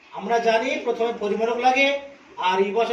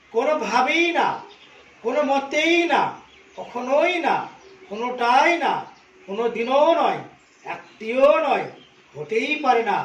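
কোনোভাবেই, মতেই, কখনোই, কোনোটাই, দিনও each punched louder with a falling end, একটিও নয় drawn out slowly; stress on every word; 3 dB louder than usual. Shown as a demonstration. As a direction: stamp each negative word separately, slowing on একটিও নয়. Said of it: "প্রত্যেকটি শব্দে জোর দেওয়া হয়েছে". (প্রতিটি শব্দে জোর, একটিও নয় ধীরে টেনে)

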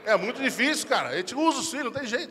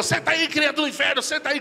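higher, commanding mother voice; stern mother; 9 semitones higher than usual. Acted. Mother ordering the troublesome child to sit.

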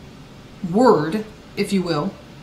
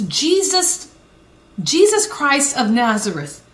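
ordinary talk, nothing marked

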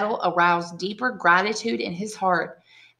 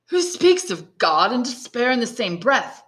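raised, lightly voiced hidden prisoner; muffled voice; 6 semitones higher, 4 dB louder than usual. A narrator's reading. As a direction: call through from underground, only lightly altered. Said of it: "said a muffled voice".

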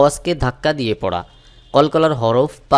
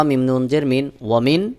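ordinary talk, nothing marked

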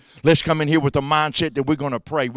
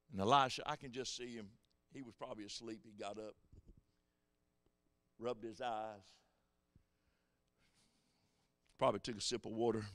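much softer, low aside; hushed storytelling; 4 semitones lower, 17 dB quieter than usual. Acted. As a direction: drop to a murmur while imagining the scene.

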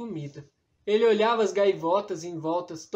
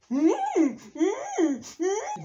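high playful creature chuckle; a small hummed dragon laugh; 12 semitones higher, much slower than usual. Acted. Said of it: "disse o passagarto".

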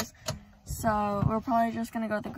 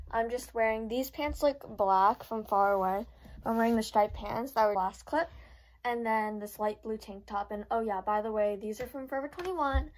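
ordinary talk

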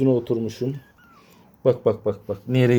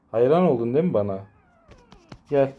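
ordinary talk, nothing marked

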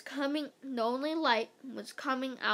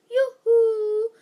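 very high, loud, drawn-out singsong call; 12 semitones higher, 13 dB louder than usual. A narrator's reading. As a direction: sing out to someone hiding. Teacher calls for missing woman.

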